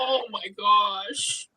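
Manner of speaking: high, slow, drawn-out delight; gushing oh my gosh. Retold or imitated. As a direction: swoon over it, very touched. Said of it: "I was like".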